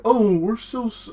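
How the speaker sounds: high-pitched snarky cartoon turtle. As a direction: put on a smug, high customer-service voice. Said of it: "He has this, like, really snarky voice".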